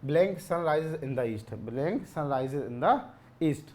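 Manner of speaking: the sentence read out word by word, very slowly; slow dictation. Shown as a demonstration. Teacher reading out question six, very slowly. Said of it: "पूछ रहा आपसे".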